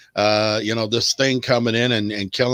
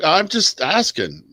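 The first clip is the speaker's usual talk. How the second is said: defensive; 8 semitones higher than usual. Real, not acted. Defending his question.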